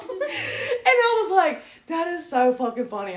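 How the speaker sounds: slow, high, laughing and almost crying; helpless with laughter retelling it. Real, not acted. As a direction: gasp it out between laughs. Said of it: "You fooling them all".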